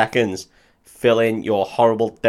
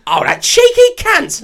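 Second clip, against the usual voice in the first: high, shrill-free Cockney mum voice, slow; 7 dB louder, slower than usual. Retold or imitated. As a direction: mum outraged on her son's behalf. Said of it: "And the mum's like".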